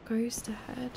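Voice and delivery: soft unvoiced warning whisper; real hushed whisper. Real, not acted. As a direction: breathe the warning. Warning quietly of a ghost.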